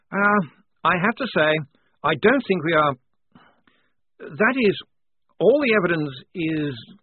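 struggling to say the truth; halting lieutenant speech; nervous officer speech. A narrator's reading.

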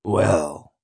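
low, grudging growl. Acted. Spring doubting Sanchez's promise, growling. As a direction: growl it, unconvinced. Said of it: "growls Spring".

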